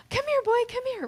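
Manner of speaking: high, loud, excited call to a dog; high-pitched excited dog call. Shown as a demonstration. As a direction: call the dog like you mean it. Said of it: "get your voice, like, excited".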